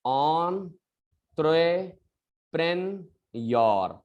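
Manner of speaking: "entrepreneur" in long separated syllables, on-troe-pren-yor; correct pronunciation. Shown as a demonstration. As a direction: pause after each syllable of "entrepreneur". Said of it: "this is the correct pronunciation".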